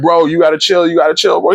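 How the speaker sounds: pleading colleague. Retold or imitated. Wagner begging the gunman to chill, mildly urgent. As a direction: talk him down, a bit panicked. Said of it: "Wagner's like".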